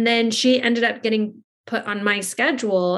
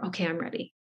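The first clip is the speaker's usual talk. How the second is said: put-on much softer, small child voice; quiet; 4 semitones lower, 11 dB quieter, faster than usual. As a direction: say it softly and shyly. Child quietly ready again.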